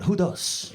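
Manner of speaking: "Judas" said as "Hudas", Spanish style. Retold or imitated. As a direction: tease him with "Judas" said as "Hudas". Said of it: "it's Judas".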